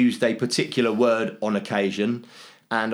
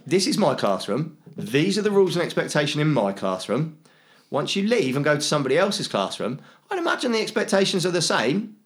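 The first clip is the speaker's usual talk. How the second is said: higher-pitched, measured classroom rules voice; teacher to pupils; 6 semitones higher than usual. Acted. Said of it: "how I deal with the kids at school".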